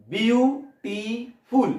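বিউ, টি, ফুল with gaps; slow three-part demonstration of বিউটিফুল; syllable-by-syllable. Shown as a demonstration. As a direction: say বিউটিফুল very slowly in three separate beats. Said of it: "ভালো করে শোনো".

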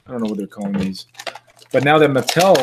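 ordinary talk

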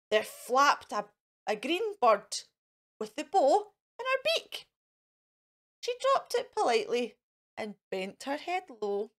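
ordinary delivery

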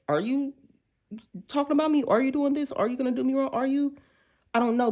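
high nervous questions; insecure questioning. Retold or imitated. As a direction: voice the worry of someone hurt before. Quoting insecure heartbroken self.

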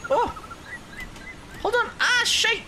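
sudden high yelp of surprise; startled yelp. Real, not acted. Gamer yelping at a missed jump, sudden high startled cry. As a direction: yelp as the jump goes wrong.

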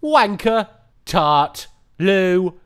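hammed-up British accent; lightly put-on British accent with slang; jokey British accent rant; mock British accent. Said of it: "speaking with a British accent".

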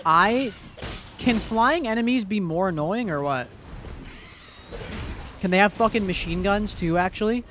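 frustrated rant. Real, not acted. Ranting at flying enemies.

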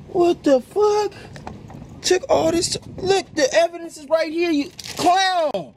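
real furious high-pitched accusation; outraged. Real, not acted. Finds evidence, accuses girlfriend.

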